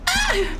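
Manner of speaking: short high shriek of fright; startled scream. Real, not acted. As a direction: yelp at the sudden danger. Sudden hazard in game.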